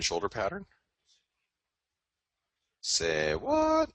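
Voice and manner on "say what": mock surprise. Mock reaction to chart pattern.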